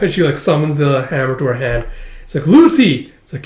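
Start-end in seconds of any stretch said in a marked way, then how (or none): 2.5-3.1 high, loud shout of a name; retold cry to a friend; acted alarmed cry. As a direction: call out in alarm.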